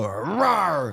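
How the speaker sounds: mock gorilla roar. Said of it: "for him to just go".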